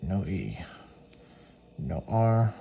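ordinary delivery

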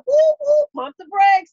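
high, loud "whoa there" call; loud, playful slow-down warning; urgent reining-in. Retold or imitated. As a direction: holler to halt someone mid-rant. God voiced reining her in.

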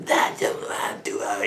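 whispered sarky brush-off, barely voiced. Miles whispering back sarcastically at the studio, sarky whisper. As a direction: mutter a sulky no under the breath. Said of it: "sarky comments coming back".

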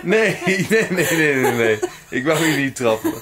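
giggling protest; being tickled. Real, not acted. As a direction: laugh out the no's.

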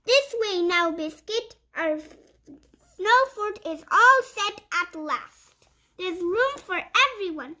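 lively picture-book read-aloud; reading to children. Acted. Reading a puppy picture book to young viewers, bright storytime voice. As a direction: read it like storytime for little kids.

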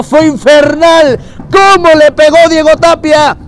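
high, loud goal celebration call; breakneck goal commentary. Real, not acted.

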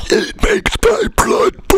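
slow, high strained whisper; a half-crying melodramatic whisper; dramatic whisper. Acted. Mock dramatic slow zoom scene.

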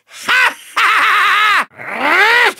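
long, high villain cackle; evil cackle. Acted. Villain gloats over a trick up his sleeve, maniacal laugh. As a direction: cackle triumphantly, drawn right out.

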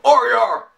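"Oreo!" clipped and high, stress on the first syllable; odd Oreo. Retold or imitated. Vlogger mimicking the rapper's odd "Oreo!". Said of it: "he says it weird".

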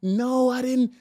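breathy whispered no. Retold or imitated. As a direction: whisper it as a secret. Whispered reply in acted quote.

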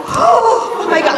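high, loud, drawn-out gasp of delight; reliving an excited shock; thrilled reaction. Retold or imitated. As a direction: exclaim it, bursting with excitement. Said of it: "I was so excited".

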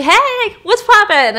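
high, loud, drawn-out casual greeting; hammed-up oblivious captain; carefree captain. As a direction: breeze in with a goofy singsong hello. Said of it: "looks like he's just there for lunch".

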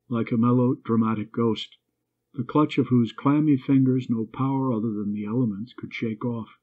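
plain reading voice

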